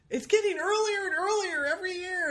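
hammed-up wail of a complainer; whiny complainer. Guest mocking people who whine about early holidays, high mock wail. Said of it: "the people who say".

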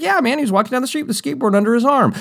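high, fast, oblivious dude voice; eager rushed insistence. Retold or imitated. Kid insisting to James, high and fast. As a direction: rattle it off fast and proud.

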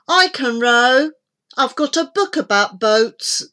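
eager. Acted. Boy eager to help.

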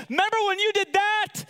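high-pitched, acted accusation; accusing. Husband bringing up an old offence to his wife, accusing. Acting passive-aggressive dredging up offence.

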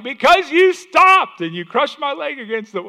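comic donkey protesting, very high and slow; talking donkey. Retold or imitated. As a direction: whine it like the wronged donkey. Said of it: "he's like".

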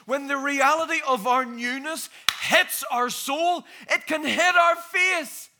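slow, high, loud preaching; preaching at full force. Acted. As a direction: build it to a ringing high point.

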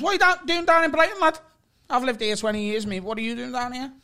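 high-pitched mock pub interrogation; imitated incredulous stranger. Acted Scouse stranger quizzing him.

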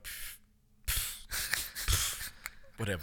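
soft breathy scoffs, then a shrugging whatever; dismissive scoff. Retold or imitated. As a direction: wave it off with mock disdain.

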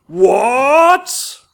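loud, high incredulous bark; outraged disbelief; incredulous what. A narrator's reading. Fat master turns on the orphan boy, shocked shout.

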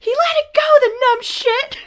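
put-on high, loud kid tattling; shrill-pitched child's outburst, mocking; Ellie. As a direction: imitate an indignant little girl. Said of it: "Ellie actually cracks me up here, 'cause she's like".

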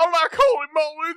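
Holy Moly squeaked very high like a cartoon hedgehog; Sonic impression. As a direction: pitch "Holy Moly" way up, hammed-up. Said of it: "you made Carl sound like Sonic".

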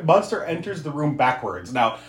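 normal talking voice

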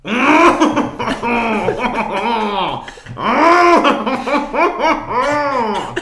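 long high helpless laugh; real loud laughter; big laugh. Real, not acted. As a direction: crack up completely.

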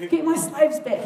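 high angry screech of a furious king; screeching Pharaoh. Acted. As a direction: screech the order at his army. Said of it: "he screeched".

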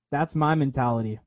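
normal talking voice

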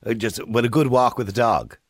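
ordinary talk

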